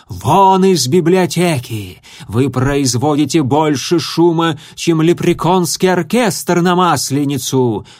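loud, slow furious dragon; angry dragon shout. A narrator's reading. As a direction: bellow them out of the room. Dragon librarian throwing them out.

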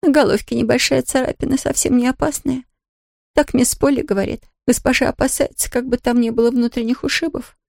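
a worried servant woman; maid voice. A narrator's reading. Nancy voiced by reader.

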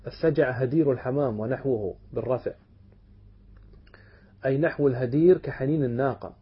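usual speaking voice

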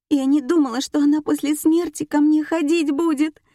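high, loud tearful wail; crying. A narrator's reading. Nika fears her dead aunt's visits, acted crying. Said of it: "заплакала Ника".

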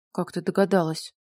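understated puzzled surprise; surprised. A narrator's reading. Nikolai caught out by Mila's guess, mildly surprised. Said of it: "удивился тот".

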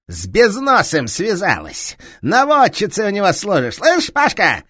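angry detective shouting down a thief; enraged police inspector. Acted. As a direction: roar at the thief with fury.

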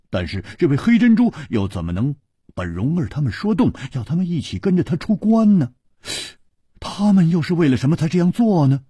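high, quick, insistent questioning; animated, puzzled friend. Acted. Friend pressing Chu about why they left, animated and puzzled. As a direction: press him with high-pitched, hurried questions.

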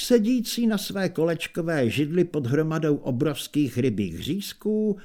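plain reading voice

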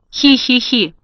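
a light teasing witch cackle. Acted. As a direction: cackle like a spiteful old witch. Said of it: "дразнила Бастинда".

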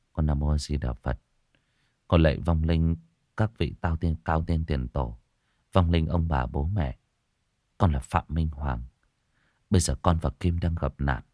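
solemn chant-like plea to the dead; murmured prayer. Acted. Prays at the ancestral altar.

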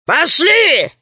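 gruff gorilla command. Acted. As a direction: bark it like a gorilla. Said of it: "сказала она".

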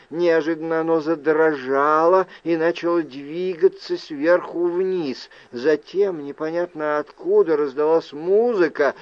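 usual speaking voice

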